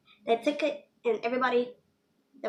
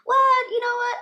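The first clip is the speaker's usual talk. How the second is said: much higher, mock-eager yes-man voice; fake agreement; 12 semitones higher, 4 dB louder, slower than usual. Playing along with the classmate, fake over-eager agreement. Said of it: "feeding into her bullcrap".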